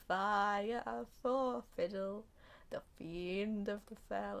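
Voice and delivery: slow, drawn-out stage delivery; theatrical recital. Acted. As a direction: intone it grandly, stretching each word. Imitates actor's theatrical line.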